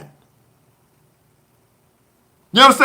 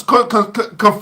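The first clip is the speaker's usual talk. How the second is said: ordinary delivery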